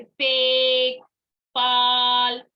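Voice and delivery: पेक and पाल loud with long held vowels; long sounds. Shown as a demonstration. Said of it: "ऐसे हम long sounds बोलते हैं". (पेक, पाल के स्वर लंबे खींचकर)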